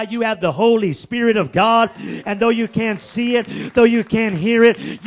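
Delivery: shouted, high, ringing preacher's declaration; preaching at full force. Acted. Preacher proclaiming to his congregation, full shouted force.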